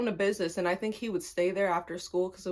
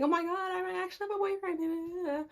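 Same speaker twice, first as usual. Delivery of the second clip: put-on young girl; 10 semitones higher than usual. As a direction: gush like a naive girl, mocking herself. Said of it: "I was just a young girl".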